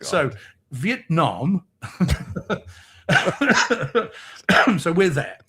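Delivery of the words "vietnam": posh British "Vietnam", long "ah" at the end; "Vietnam" in posh English style; posh pronunciation. Shown as a demonstration. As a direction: say "Vietnam" ending like "calm". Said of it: "sounds a bit posh".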